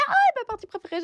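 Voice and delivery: high, fast excited gush; excited squeal. Real, not acted. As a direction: squeal with delight. Excited about favourite step.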